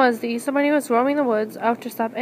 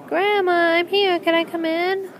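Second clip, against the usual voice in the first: calling out; 5 semitones higher than usual. Acted. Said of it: "shouted out".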